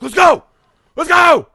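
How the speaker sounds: high, loud panicked yell; screamed urgent order. Retold or imitated. Dad to his kid as geese close in, urgent high scream. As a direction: scream it out to get your kid moving. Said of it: "screaming at these geese".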